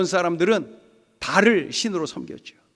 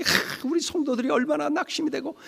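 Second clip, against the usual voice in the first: sighing, higher-pitched lament; distressed; 7 semitones higher than usual. Retold or imitated. Pastor worrying about discouraged church members, distressed. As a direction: fret aloud for the flock. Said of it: "너무나도 속상하고 힘들고".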